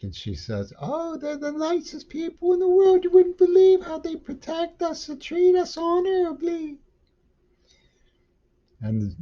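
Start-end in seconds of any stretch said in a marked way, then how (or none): 0.8-6.8 very high, sugary falsetto mockery; mock naive woman. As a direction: squeak it out like a gushing naive captive. Mocking captive woman's reply.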